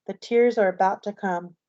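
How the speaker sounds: usual speaking voice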